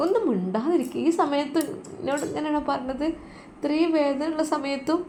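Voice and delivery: a high, soft, wounded complaint; hurt old mother. Acted. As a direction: protest weakly in pain. Mother hurt, protesting in pain.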